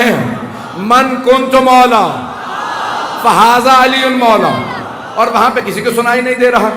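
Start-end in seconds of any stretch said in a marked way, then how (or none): 0.8-4.6 slow, drawn-out shouted proclamation, much higher and louder; proclaiming shout. Retold or imitated. Preacher voices the Prophet's proclamation at Ghadir, slow and shouted. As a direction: call it out as if to a vast crowd at Ghadir. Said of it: "غدیر میں چیخ چیخ کے اعلان کر رہے".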